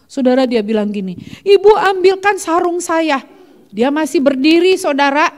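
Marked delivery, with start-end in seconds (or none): 1.4-3.2 high, louder retold plea; pitched-up urgent call; acted urgent request. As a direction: imitate a woman in labor calling for help. Retold labor, urgent request for help.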